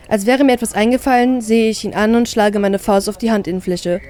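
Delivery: usual speaking voice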